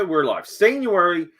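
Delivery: normal talking voice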